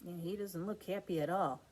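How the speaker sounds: put-on lively sing-song read-aloud for kids; picture book reading. A narrator's reading. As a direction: read it like a bedtime rhyme.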